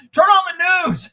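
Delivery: high, loud retold command; imitating an alarmed friend yelling; urgent caller. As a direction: shout it urgently down the line. Caller urging him, retold.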